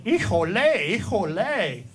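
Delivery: mock pained cry, pitch swooping up; imitated screaming. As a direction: cry out like a man in trouble, mildly hammed-up. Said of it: "I thought he was screaming".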